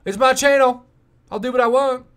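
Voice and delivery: high, loud and sassy. Pretend. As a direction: toss it off with sass. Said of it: "I don't know why I did that sassy voice".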